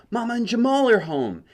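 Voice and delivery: high, loud happy announcement; excited. A narrator's reading. Boy hearing mama on the stairs, excited. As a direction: call out with joy. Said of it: "he said, but just in his head".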